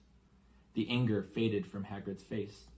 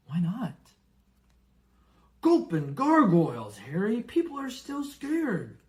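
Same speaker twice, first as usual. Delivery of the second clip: loud exclamations dropping to whispers; uneasy giant; 5 dB louder than usual. Acted. Giant nervously tells the boy about the dark wizard. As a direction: hush on the scary words. Hagrid afraid to say the name.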